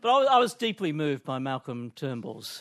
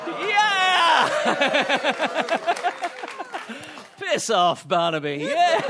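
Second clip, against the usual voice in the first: cheering; 10 semitones higher, 6 dB louder, much slower than usual. Real, not acted. Cheering Barnaby's exit.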